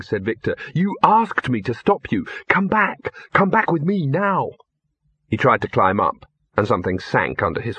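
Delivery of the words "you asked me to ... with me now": high, insistent urging; urgent pleading. A narrator's reading. Victor begs Ginger to come back, urgently. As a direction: urge her back, pitch rising.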